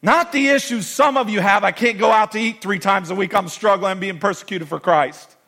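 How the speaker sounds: loud, high, forceful preaching; preaching at full force. Acted. Pastor preaching loudly to the church. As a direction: preach it hard to the crowd.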